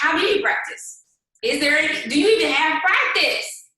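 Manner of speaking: emphatic rising challenge; loud challenge. Acted. Rhetorical challenge to team.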